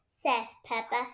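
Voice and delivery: usual speaking voice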